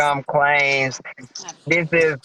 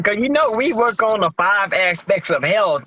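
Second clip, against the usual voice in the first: ordinary talk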